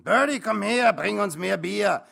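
boozy, high-pitched bellow; drunken shout. A narrator's reading. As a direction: yell the order out drunkenly. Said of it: "rief einer von ihnen besoffen".

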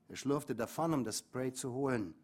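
ordinary talk

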